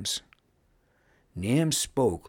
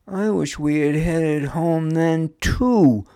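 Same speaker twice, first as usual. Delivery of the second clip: loud, high moan of regret; moaning complaint; 9 semitones higher, 8 dB louder than usual. A narrator's reading. Daub regrets not heading home, moaning. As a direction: moan about it, wishing for home. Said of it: "moaned Daub".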